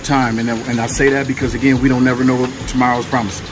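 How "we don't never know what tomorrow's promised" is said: normal talking voice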